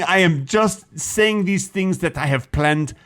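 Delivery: put-on stilted French-accented English. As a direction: play a French person struggling in English. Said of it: "a French person trying to speak English".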